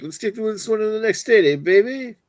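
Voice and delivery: hammed-up tough guy hyping the beer; a light mobster impression, cheering; wise-guy voice. Echoing comment in wise-guy voice.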